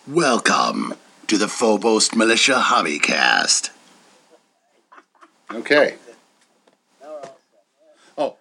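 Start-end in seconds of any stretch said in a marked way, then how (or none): 0.1-3.7 very low, heavy hero voice; deep Batman voice. Shown as a demonstration. Perfect take of the podcast intro as Batman. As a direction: sink deep into the words. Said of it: "Last one".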